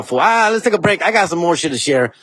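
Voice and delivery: ordinary talk, nothing marked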